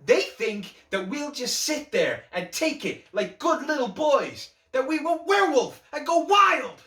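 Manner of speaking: high, loud, anguished outburst building to a yell; Joker's angry rant. Acted. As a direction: rant at the talk-show host, escalating to a frenzy. Acted Joker monologue, angry rant.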